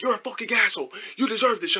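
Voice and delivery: high-pitched imitated warning; mock heated argument, no real shouting; mock arguing. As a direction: warn someone in a put-on high angry voice. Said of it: "we were just going back and forth".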